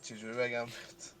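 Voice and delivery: shy whisper trailing off; bashful whisper. Real, not acted. Shy confession to caller.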